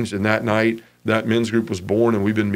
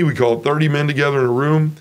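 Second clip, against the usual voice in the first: normal talking voice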